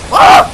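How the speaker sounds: very high, loud yelp; startled shriek. Real, not acted. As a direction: shriek in sudden alarm. Scared while climbing up.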